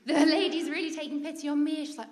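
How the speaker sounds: ordinary talk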